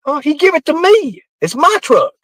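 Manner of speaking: high-pitched put-on voice insisting the truck is his; mock indignant claim. [name] insisting to John Paul the truck is his, in a high mock-indignant voice. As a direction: squeak out the claim, mock offended.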